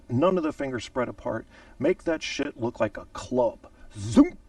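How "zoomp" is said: punchy mouth-made whoosh; zoomp sound effect. Shown as a demonstration. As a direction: snap the sound out like the salute. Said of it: "You pop it up".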